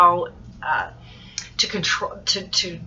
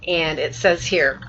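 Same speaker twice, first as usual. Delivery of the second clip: usual speaking voice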